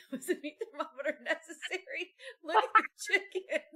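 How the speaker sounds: loud, laughing mock question; teasing through laughter. To husband about the meat thermometer, loud laughing tease. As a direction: tease him while cracking up. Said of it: "I said".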